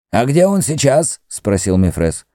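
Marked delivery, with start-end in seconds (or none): 0.1-1.2 high-pitched questioning priest; Mefres the priest. Acted. High, pressing priest's voice. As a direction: priest asks the entranced Greek where he is, high and insistent.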